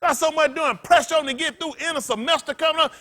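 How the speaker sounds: ordinary talk, nothing marked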